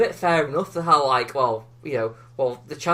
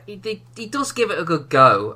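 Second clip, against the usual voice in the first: normal talking voice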